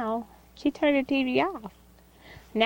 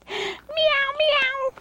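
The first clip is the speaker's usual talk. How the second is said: very high pretend cat mew; high-pitched toy-cat meows; playful kitty meow; much slower than usual. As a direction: mew like a tiny kitten. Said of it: "You see the kitty cat?".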